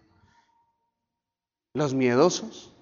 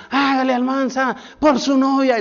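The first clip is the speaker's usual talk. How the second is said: much higher, louder rallying yell; sergeant's shout; 11 semitones higher, 7 dB louder than usual. Retold or imitated. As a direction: fire up the recruit at full volume. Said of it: "el sargento decía".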